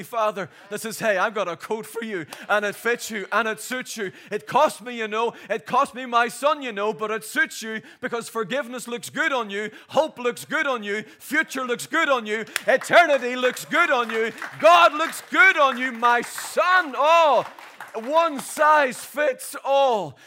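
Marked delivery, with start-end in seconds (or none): 1.0-16.9 rising louder and louder, preaching; from warm offer to loud preached climax; building praise. Acted. God's voice, climbing crescendo. 16.9-20.2 loud, very slow drawn-out proclamation; loud, slow preacher's cry; preaching shout. Real, not acted.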